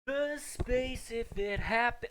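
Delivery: high, slow mocking echo. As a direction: parrot the line back high and drawn out. Echo of line 59.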